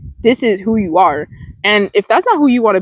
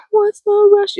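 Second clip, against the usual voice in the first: high-pitched; 12 semitones higher than usual. Shown as a demonstration. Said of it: "I know I said that all wrong".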